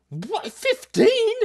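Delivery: high, loud surprised question; shocked at the age; a squeaky disbelieving fifteen. Real, not acted. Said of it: "surprised reaction".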